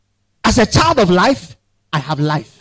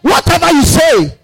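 "Whatever" called out high; preached shout; 7 semitones higher than usual. Acted. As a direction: belt out "Whatever you say". Preaching burst.

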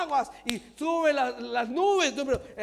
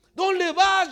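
shouted rebuke; 4 semitones higher, 8 dB louder than usual. Acted.

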